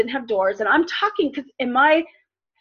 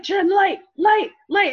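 put-on scared child pleading; 8 semitones higher, 5 dB louder, slower than usual. Scared toddler begging for light.

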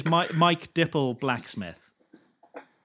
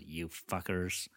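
leaning in, low; 6 semitones lower, 10 dB quieter, slower than usual. Retold or imitated. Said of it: "he leans in and he goes".

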